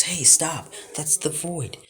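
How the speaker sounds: high, urgent shout to stop; alarmed warning. A narrator's reading. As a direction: call out a sudden warning. Everybody warns him off the void.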